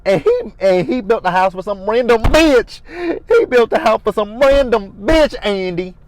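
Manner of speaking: high, loud mock wail in her accent; mock sobbing rant in Karen's accent. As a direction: overdo her tearful accent. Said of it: "that accent that Karen uses".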